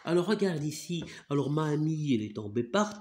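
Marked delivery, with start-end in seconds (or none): none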